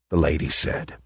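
ordinary delivery, nothing marked

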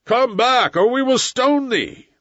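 high, threatening mob cry; crowd shouting. A narrator's reading. Crowd threatens Messua with stoning, shouting. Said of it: "shouted the crowd".

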